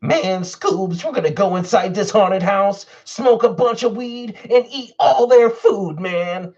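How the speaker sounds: high-pitched cartoon slacker talking to his buddy; cartoon sidekick voice. Cartoon impression addressing Scoobs.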